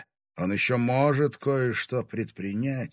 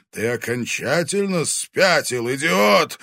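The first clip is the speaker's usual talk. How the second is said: louder outburst rising to a peak; outraged insult; 6 semitones higher, 8 dB louder than usual. A narrator's reading. Dragon berating Timofey as mad, outraged. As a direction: build to a shout on the insult. Потрясённый дракон ругает Тимофея.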